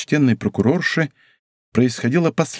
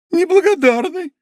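much higher reproachful cry; reproachful outburst; 4 dB louder than usual. Acted. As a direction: hurl the word at him in hurt. Wife's outburst at Porthos. (обиженно, гораздо выше)

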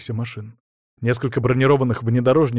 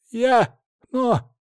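high, halting stammer; 11 semitones higher, much slower than usual. Acted. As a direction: stumble over the words. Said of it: "заикался он".

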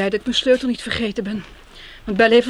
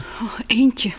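whisper. Acted. Shy answer about sharing a flat.

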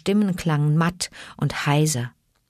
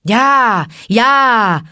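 loud, higher yelped answer; yelping; 6 semitones higher, 9 dB louder than usual. Acted. The wolves answering Mowgli, loud yelps. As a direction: yelp back eagerly. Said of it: "jaulten die Wölfe".

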